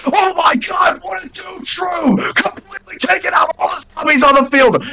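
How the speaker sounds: hammed-up excited play-by-play; over-the-top Wipeout commentator. Shown as a demonstration. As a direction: go wildly overboard calling the action. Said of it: "like, all over the top".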